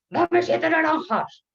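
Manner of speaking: high, loud, slow angry order; angry demand. Acted. Angry concierge ordering from the fruit seller, furious acted shout. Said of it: "toda enfadada".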